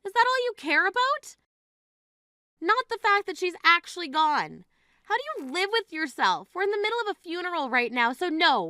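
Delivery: high-pitched, indignant telling-off; outraged scolding. Acted. Sister at mom's funeral scolding the bride, outraged.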